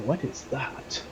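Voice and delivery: soft, distracted question; hushed puzzlement. Captain noticing something over Lexi's shoulder, hushed puzzlement. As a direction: say it under the talk, attention caught.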